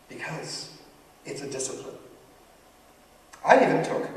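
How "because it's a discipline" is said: softly quoted teacher's answer, with a pause; quiet stock answer. Retold or imitated. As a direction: hand down the old answer in a hushed voice.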